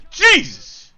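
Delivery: very loud, high exclamation; explosive reaction to a play; astonished shout. Real, not acted. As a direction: yell it in real disbelief. Reacts to the save.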